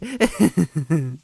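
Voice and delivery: high mocking cackle; witch cackle. A narrator's reading. Villain laughing at the heroes, high witch cackle.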